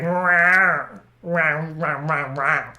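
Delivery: meows fading away one after another; lightly voiced cat chatter; string of meows. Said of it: "be like".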